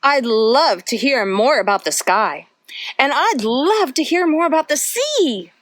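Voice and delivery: put-on lively storybook read-aloud for little kids; reading to children. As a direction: read it like a bedtime picture book, full of wonder. Reads picture book to children.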